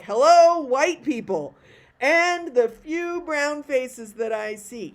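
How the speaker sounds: loud, high mock welcome; drawn-out, exaggerated greeting to the crowd; tactless host. Host singling out the audience, exaggerated welcome. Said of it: "You would never say".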